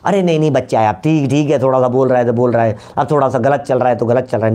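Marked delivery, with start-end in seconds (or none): none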